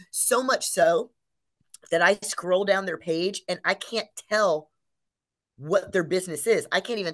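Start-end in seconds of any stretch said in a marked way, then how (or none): none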